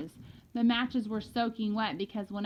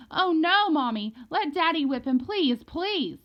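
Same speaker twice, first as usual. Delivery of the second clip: high, loud hysterical begging; child crying out in desperation; frantic; 7 semitones higher, 6 dB louder than usual. A narrator's reading. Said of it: "went crazy".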